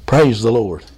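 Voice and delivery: very low-pitched, steady praise. Shown as a demonstration. Preacher leading the congregation to say it with him, low voice. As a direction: drop right down low to lead them. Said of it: "In a, a low voice or a loud voice".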